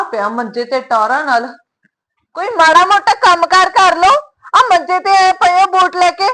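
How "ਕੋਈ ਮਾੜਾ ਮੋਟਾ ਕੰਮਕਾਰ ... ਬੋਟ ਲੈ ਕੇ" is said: higher, louder, slow nagging scold. Acted. Wife scolding husband lying idle on the cot, loud nagging. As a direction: scold him for lazing around.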